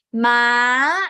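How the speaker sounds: loud "Ma" level, then sweeping up; straight then rising tone. Shown as a demonstration. Teacher giving a sound to imitate, rising "Ma". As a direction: hold "Ma" steady and lift the end. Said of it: "Listen to my sound and hum".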